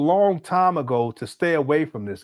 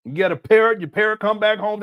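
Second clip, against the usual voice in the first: normal talking voice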